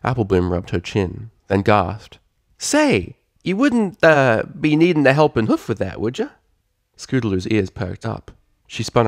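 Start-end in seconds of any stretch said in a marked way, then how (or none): 2.6-6.3 high, coaxing filly voice with a country lilt; hint at helping, sly and sweet; hopeful filly. Acted. Applebloom offering the mare help, high hopeful filly voice.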